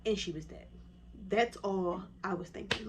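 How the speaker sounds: ordinary delivery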